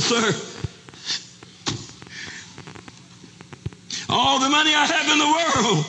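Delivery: high, strained appeal to the auctioneer; impassioned plea. Acted. Bidder offering all his money to the auctioneer, impassioned plea. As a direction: plead it at a high pitch.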